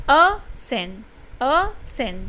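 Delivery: each ascend drawn apart as "a", pause, "scend"; teacher sounding out ascend syllable by syllable; split into syllables. Shown as a demonstration. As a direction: say ascend in two separate beats, a - scend. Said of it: "दो बार हमारे मुंह की posture बदली".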